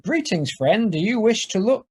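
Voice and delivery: a touch of a robocall greeting; Homer's recorded voice. Acted. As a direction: sound like a canned phone pitch. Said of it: "Homer's voice comes out of the phone".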